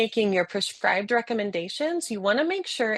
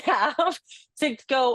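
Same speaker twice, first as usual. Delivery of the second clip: usual speaking voice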